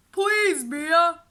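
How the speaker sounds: begging. A narrator's reading. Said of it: "Henry begged her".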